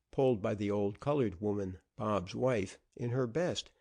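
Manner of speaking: ordinary delivery, nothing marked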